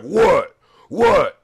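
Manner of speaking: loud high challenging shouts; confrontational. Retold or imitated. Friend confronting a stranger, loud challenging. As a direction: get in the guy's face. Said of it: "He's like".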